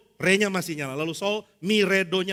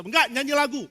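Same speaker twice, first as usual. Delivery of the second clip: higher, pushy contradiction; stubborn insistence; 6 semitones higher than usual. Retold or imitated. Liturgist overrides the preacher, insisting on a song, higher and pushy. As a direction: cut him off stubbornly.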